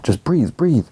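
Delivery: high-pitched, mildly panicky urging; acted alarm, pitch jumping up; mock panic. [name] to gasping Rhonda, imitated panic.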